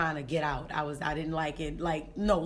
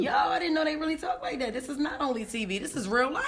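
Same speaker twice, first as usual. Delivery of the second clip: amazed shout; 7 semitones higher than usual. Retold or imitated. Amazed at party talk.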